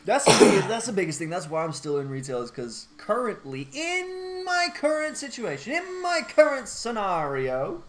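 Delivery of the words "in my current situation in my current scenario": slow high pompous phrasing; mock formal. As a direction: stretch it out pompously.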